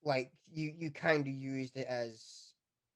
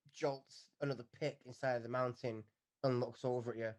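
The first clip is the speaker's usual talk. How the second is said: usual speaking voice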